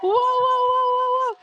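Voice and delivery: high, loud string of whoas; excited whooping. Real, not acted. Host reacting to the live audience, excited whoops. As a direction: whoop over a cheering crowd.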